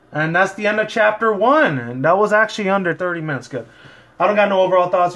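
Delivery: plain reading voice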